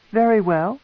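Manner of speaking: high, reproachful matron voice; stern matron. Acted. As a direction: remind him coldly as a queen.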